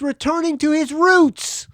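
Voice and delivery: high, loud announcer climax; big, triumphant blurb finish; hyped promo read. Acted. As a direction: belt it out like a rock promo.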